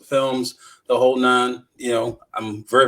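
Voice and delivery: ordinary talk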